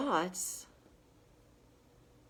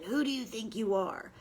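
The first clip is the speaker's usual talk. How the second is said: demonic inner voice; 4 semitones higher than usual. Retold or imitated. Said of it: "how demonic my voice".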